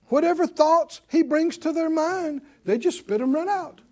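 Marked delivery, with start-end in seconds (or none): none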